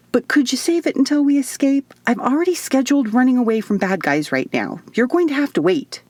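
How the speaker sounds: high, bright-eyed, eager chatter; chirpy crazy woman. A narrator's reading. Isadora gleefully tells the woman to save her hysterics for later. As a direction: play her as giddy and unhinged.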